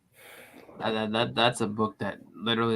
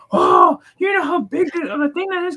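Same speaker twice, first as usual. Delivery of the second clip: very high-pitched Muppet-like shouting; excited Jamie impression; 5 dB louder than usual. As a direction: imitate an excited friend in a squeaky Muppet voice. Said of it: "infringing on K-pop's Muppet voice".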